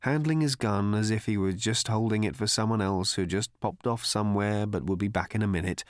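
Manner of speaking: ordinary delivery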